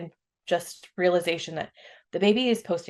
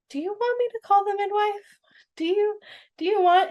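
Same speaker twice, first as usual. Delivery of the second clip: high mock voice of a gentle husband; sweet husband; 13 semitones higher than usual. Husband kneeling by the tub offering to call the midwife, high and gentle. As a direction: lightly play the sweet, worried husband, pitched up. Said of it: "so sweetly gets down next to me".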